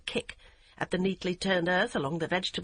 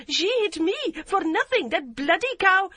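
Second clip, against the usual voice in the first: furious protest; 11 semitones higher, 7 dB louder than usual. A narrator's reading. Yum complaining furiously.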